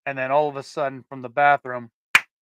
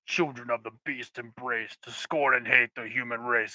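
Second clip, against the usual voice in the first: demonic voice; 3 semitones lower, 5 dB quieter than usual. Retold or imitated. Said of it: "like, demonic voice".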